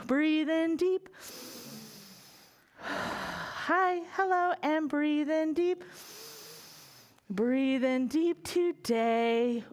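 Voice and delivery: slow, calm breathing cue; guided breathing. Shown as a demonstration. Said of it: "We're gonna breathe in deep".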